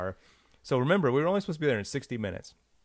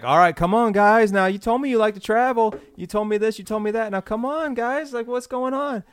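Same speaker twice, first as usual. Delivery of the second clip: much higher and loud pressure; pushy salesman; 9 semitones higher, 6 dB louder than usual. Retold or imitated. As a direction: lean on them like a frustrated pitchman.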